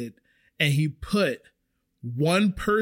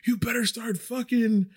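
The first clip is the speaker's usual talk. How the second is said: retold yell; 7 semitones higher than usual. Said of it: "the manager who's yelling at me".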